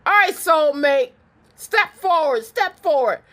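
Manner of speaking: loud, high playful summons; calling out. Pretend. Reader summoning a viewer's soulmate to step forward, calling out. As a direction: call them up to the front, full voice.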